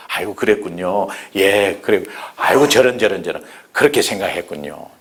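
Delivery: tender listening reply; gentle sympathy. Shown as a demonstration.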